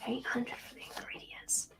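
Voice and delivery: soft, whispery character voice; hushed dismay. Acted. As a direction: mutter over it, half to yourself. Herbalist mutters over plant's price.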